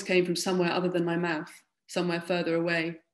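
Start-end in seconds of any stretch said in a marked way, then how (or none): none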